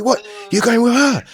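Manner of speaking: high squeaky disbelief; a sudden high-pitched what of disbelief; shocked squeaky what. Retold or imitated. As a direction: squeak it out like you can't believe it. Friend's shocked reaction retold.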